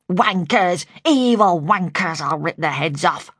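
gruff, angry masculine cursing; growled cursing. A narrator's reading. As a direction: curse and growl like an enraged man. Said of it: "She growled again".

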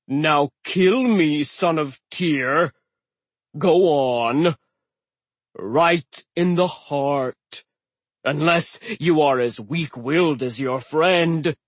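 slow loud taunting giant; mocking giant. A narrator's reading. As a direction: goad them into the killing blow. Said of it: "tone was too mocking, too eager".

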